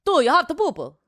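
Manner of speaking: high, sing-song Italian accent. Shown as a demonstration. Taking a turn at accents, Italian accent. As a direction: ask it as a stagey Italian. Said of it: "How about an Italian?".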